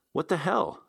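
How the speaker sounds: high, startled exclamation; sudden shocked outburst. Acted. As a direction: jump in shock. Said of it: "I was actually shocked".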